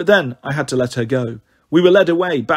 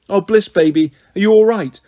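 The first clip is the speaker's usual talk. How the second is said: put-on raised, worried voice calling to her child; urgent, higher and louder, desperate to reach her; anxious mother; 5 semitones higher, 5 dB louder than usual. Mother calling anxiously to her sleeping daughter, worried and loud.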